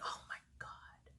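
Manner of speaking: a slow, breathless whispered oh my God; whispered dismay. Retold or imitated. After the doctor says she's staying overnight, a whispered dismayed aside. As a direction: hush it out in disbelief.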